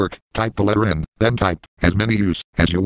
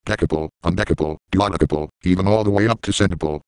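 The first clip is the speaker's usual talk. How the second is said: usual speaking voice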